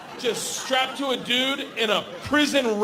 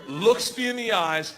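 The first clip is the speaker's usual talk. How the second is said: ordinary talk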